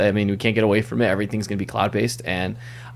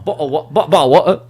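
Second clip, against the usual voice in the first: higher, louder, stumbling British accent; British accent attempt; 7 semitones higher, 7 dB louder than usual. Shown as a demonstration. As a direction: fumble repeatedly at a British accent. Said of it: "in a British accent".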